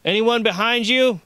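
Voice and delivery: high, loud shout; yelled question. A narrator's reading. Guard checking with Josh for anyone behind him, yelling. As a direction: yell it so it carries. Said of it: "he yelled".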